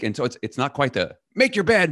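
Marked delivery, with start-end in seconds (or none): 1.3-1.9 bossy parent. Retold or imitated. Bossy parent order, mimicked.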